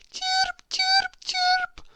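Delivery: very high, piping little bird chirps; sweet high-pitched bird calls; birdsong chirps. Acted. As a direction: chirp like tiny dawn birds. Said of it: "went the birds".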